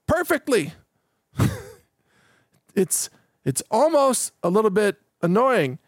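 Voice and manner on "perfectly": PERFECTLY high-pitched, each syllable exact; mock a too-perfect AI saying PERFECTLY; over-precise AI diction. Host mocking AI's flawless reading of scripts, high and over-precise. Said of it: "they pronounce everything perfectly".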